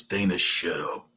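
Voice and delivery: low, soft creature voice saying Dana, shut up; bedbug voice. Shown as a demonstration. Streamer telling Dana to shut up in bedbug voice, low. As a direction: croak it like a tiny bug. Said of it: "In my bedbug voice".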